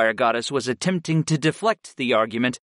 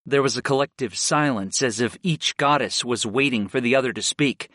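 usual speaking voice

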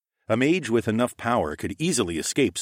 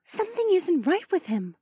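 wary bird companion. A narrator's reading. Companion voices suspicion.

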